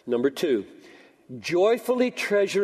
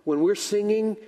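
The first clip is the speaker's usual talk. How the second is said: normal talking voice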